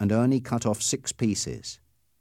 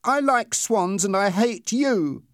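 high, loud, slow outburst of hatred; angry cry; 11 semitones higher, 4 dB louder, slower than usual. Acted. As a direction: hurl it at the bully. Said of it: "Peter cried".

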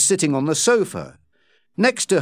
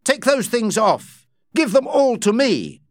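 stern order; 8 semitones higher, 4 dB louder than usual. Acted. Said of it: "Rawdon ordered".

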